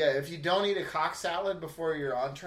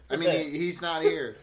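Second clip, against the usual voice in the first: ordinary talk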